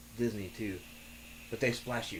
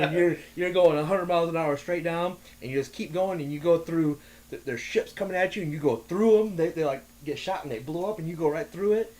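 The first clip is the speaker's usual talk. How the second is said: excited; 5 semitones higher, 5 dB louder than usual. Real, not acted. Excited ride retelling.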